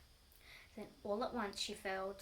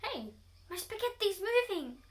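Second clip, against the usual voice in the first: much higher and louder surprise; startled outcry; 9 semitones higher, 11 dB louder than usual. Acted. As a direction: yelp it out in shock. Husband notices worms.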